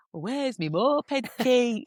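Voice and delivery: slow, drawling northern English; Danny. Retold or imitated. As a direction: imitate a ditzy northern English girl, vowels stretched. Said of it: "really ditzy anyway and really northern".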